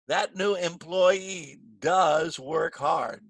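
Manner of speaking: "does" stretched out slowly; slow, emphatic "does"; stress on DOES. Shown as a demonstration. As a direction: admire by leaning on "does".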